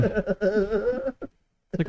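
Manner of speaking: normal talking voice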